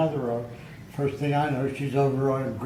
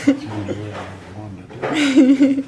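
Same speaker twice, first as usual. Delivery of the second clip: laughing; 13 semitones higher, 11 dB louder than usual. Real, not acted. Joking reply, laughing.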